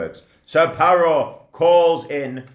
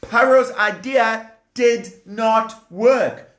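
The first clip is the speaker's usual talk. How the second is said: ordinary delivery, nothing marked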